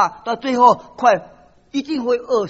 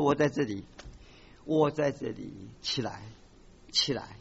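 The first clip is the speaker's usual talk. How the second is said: gentle voice of God; 10 semitones lower, 11 dB quieter than usual. Acted. God speaks to the weak believer.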